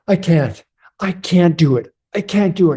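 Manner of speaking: high, fast, flustered voice of a man who can't pour; anxious stuck man. Retold or imitated. Man who can't pour the coffee, refusing help in a high flustered voice. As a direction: play a nervous guy insisting he must do it himself.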